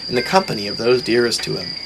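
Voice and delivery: ordinary delivery, nothing marked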